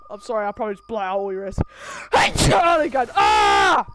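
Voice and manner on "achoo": sudden loud sneeze. Real, not acted. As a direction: sneeze hard.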